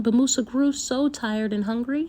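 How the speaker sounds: plain reading voice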